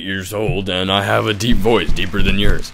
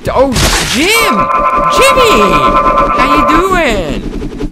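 very high, loud squealed greeting; excited calling out; 13 semitones higher, 5 dB louder, much slower than usual. Acted. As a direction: shriek the name, then stretch out the greeting. Calling out to Jimmy in character.

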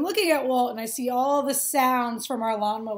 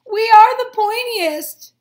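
loud, higher boast; proud boast; 9 semitones higher, 14 dB louder, slower than usual. Acted. Pointy grass bragging loudly. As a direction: brag it out.

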